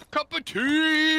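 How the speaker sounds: loud, high, drawn-out British Bigfoot cry; mock British roar. Player imitates Bigfoot's roar for his friend, mock British. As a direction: roar it slowly as a comically British monster. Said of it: "he's like ... it sounded like a British".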